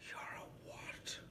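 whispered, disbelieving mum; stunned whisper. Retold or imitated. Belfast mum hearing her son come out, stunned whisper. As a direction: breathe it out in shock. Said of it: "she's like".

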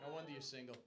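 usual speaking voice

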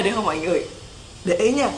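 ordinary delivery

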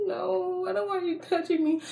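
high, whimpery refusal; mock scared whine. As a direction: plead in a put-on frightened whine. Scared refusal of being touched, retold.

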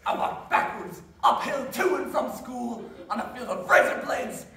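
put-on loud, cranky old Maine man; annoying old Maine guy. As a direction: grumble like a crusty old Mainer. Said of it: "Annoying old guy from Maine".

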